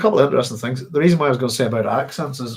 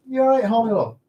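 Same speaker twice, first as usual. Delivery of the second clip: high, lilting voice for a beloved pet; fond pet talk; 10 semitones higher than usual. Real, not acted. Streamer breaks off to check on Holly, fond pet talk. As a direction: check on the dog in a much higher, doting voice. Talking fondly to the dog.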